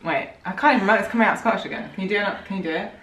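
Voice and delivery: normal talking voice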